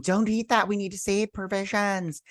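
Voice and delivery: high put-on scolding voice; imitating her. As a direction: mimic her fussing, pitched well up. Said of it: "She's like".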